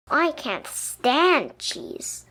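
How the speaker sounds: ordinary delivery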